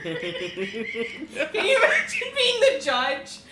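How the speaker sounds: talking through laughter, loud; giggling out the words; laughing. Real, not acted. Laughing while describing the scene.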